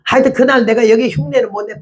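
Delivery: usual speaking voice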